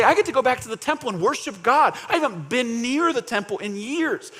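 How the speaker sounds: high, impassioned protest; emotional pushback. Acted. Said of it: "Emotionally, I think they would push back".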